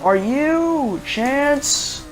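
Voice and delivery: high, drawn-out roll call; substitute teacher. Acted. Substitute teacher botching a student's name, puzzled roll call. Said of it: "like a substitute teacher".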